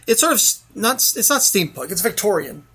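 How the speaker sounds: ordinary talk, nothing marked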